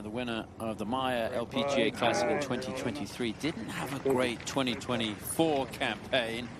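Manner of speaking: usual speaking voice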